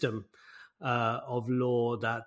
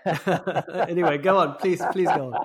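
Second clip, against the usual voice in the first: amused, laughing invitation to continue; 5 semitones higher, 6 dB louder than usual. Real, not acted. Host handing back to the guest after a shared coincidence, laughing. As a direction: urge on through laughter, louder and higher.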